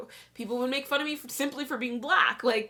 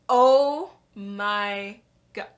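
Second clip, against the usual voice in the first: slow, stretched-out stunned gasp of words; retold shock; 7 dB louder, much slower than usual. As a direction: drag it out, stunned and loud. Shocked reaction on seeing the post.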